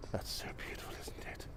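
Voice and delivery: hushed, voiceless whisper of wonder; real whisper. Real, not acted. Vlogger admiring a beautiful view to viewers, whispering. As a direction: whisper it as if not to disturb the view. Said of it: "I don't know why I'm whispering".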